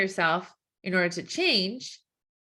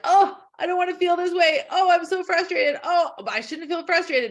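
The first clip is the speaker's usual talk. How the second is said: higher, louder, fed-up complaining; exasperated self-talk; frustrated whine; 7 semitones higher, 5 dB louder than usual. Acted. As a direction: fight the feeling out loud. Voicing resisting frustration.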